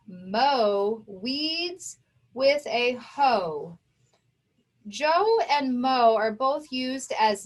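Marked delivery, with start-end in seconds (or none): 0.1-3.8 each word read slowly and clearly for the class; slow sentence reading. Shown as a demonstration. Teacher reading a practice sentence to students, slow and clear. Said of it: "let's look at the next sentence".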